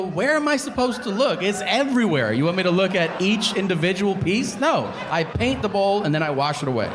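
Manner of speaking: high-pitched indignant rant; worked-up, defensive protest in a high voice; exasperated husband. Retold or imitated. Husband defends not looking, exasperated.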